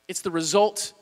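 usual speaking voice

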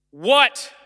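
sharp, loud, irritated "What?"; irritated snap. Retold or imitated. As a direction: bark it at the knocking kids. Dad snapping at kids at door.

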